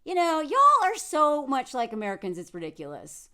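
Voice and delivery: ordinary talk, nothing marked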